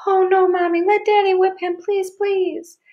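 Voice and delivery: high-pitched, frantic begging; panicked little girl crying out; hysterical. A narrator's reading. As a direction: wail the plea in a child's panic. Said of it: "went crazy".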